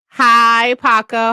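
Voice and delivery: loud cheery hi; excited hello. Real, not acted. Streamer greeting viewer Paco, loud and cheery. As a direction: greet a friend with a big hello.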